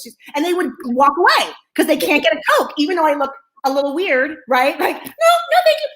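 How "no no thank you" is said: very high, chirpy mock politeness; high-pitched refusal. Showing her only answer at first, high sing-out refusal. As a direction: refuse in a high, loud squeak.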